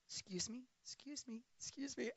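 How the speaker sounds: very soft, small polite voice; barely audible, slipping past unnoticed; meek retold excuse. Retelling leaving a silent crowd, meek and hushed.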